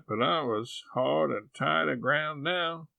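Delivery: ordinary delivery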